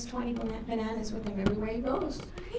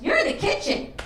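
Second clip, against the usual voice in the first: put-on high-pitched exclamation of a kid; daughter's indignant retort; 4 dB louder than usual. Daughter answering mother who asked about knocking, indignant high retort. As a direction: shoot back in a much higher voice.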